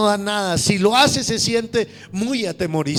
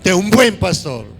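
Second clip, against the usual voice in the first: loud, fervent preaching; rousing sermon delivery; 4 semitones higher, 6 dB louder than usual. Acted. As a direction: preach it out to the congregation. Preaching to congregation at full force.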